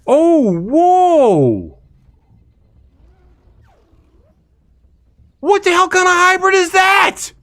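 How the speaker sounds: high, loud astonished yelling; shocked shout. Real, not acted. Streamer yelling at a bizarre hybrid bug in shock. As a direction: yelp in disbelief at the screen. Shocked by weird game creature.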